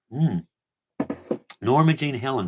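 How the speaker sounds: ordinary talk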